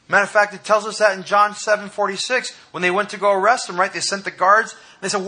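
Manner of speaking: high, loud impassioned preaching; fiery sermon climax; preaching at full force. Acted. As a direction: drive it home to the congregation.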